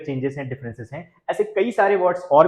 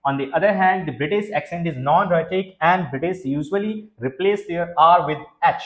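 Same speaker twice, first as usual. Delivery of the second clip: slow, careful read of the English line; slow reading aloud; much slower than usual. Shown as a demonstration. Teacher reading the slide to students, slow and careful. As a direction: read it out slowly for the class. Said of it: "जी हाँ".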